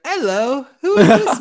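put-on very high, loud little kid in a British accent; singing out in a mock British child voice. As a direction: imitate a toddler with a Peppa Pig British accent. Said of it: "speak in a British accent for certain things".

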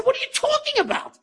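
very high, incredulous outburst; retold disbelief. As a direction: re-enact being floored by the news, pitch soaring. Retelling his disbelief at news.

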